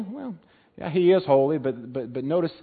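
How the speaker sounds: normal talking voice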